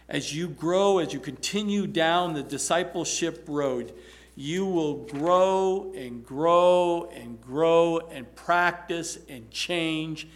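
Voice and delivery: loud, slow, stretched-out prayer; fervent preached prayer. Acted. Pastor praying over listeners' growth, loud and fervent. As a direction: pray it over them with rising force.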